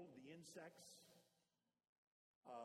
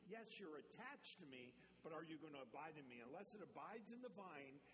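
loud, higher preacher voice driving the verse home; full-force preaching; 4 semitones higher, 4 dB louder than usual. Acted. Pastor drives John fifteen home to the church, loud preaching. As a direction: preach it hard to the congregation.

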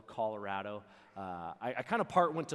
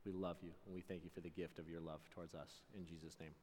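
prayer; 4 semitones lower than usual. Real, not acted. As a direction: pray it gently over the crowd. Closing prayer.

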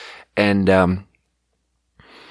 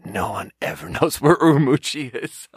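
higher-pitched imitation of an old man, breaking into laughter; ninety-year-old man; 6 semitones higher than usual. Waiter retelling an old customer's exclamation, laughing old-man imitation. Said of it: "this ninety-year-old man is like".

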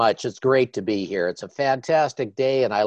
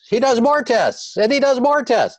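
rising higher with each repeat; building emphasis; 12 semitones higher than usual. Real, not acted. Mocking endless doctor tests.

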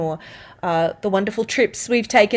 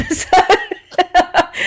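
laughing; 8 semitones higher than usual. Real, not acted.

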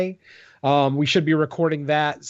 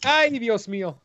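exaggerated Spanish-accented exclamation, higher; 7 semitones higher than usual. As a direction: throw up a mock Spanish "Ay, Dios mío". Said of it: "Did you just say something in Spanish".

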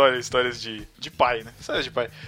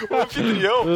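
O anfitrião said higher through a laugh; 6 semitones higher than usual. Real, not acted. Friends keep saying host, he laughs repeating O anfitrião. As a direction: laugh as you repeat O anfitrião against the teasing.

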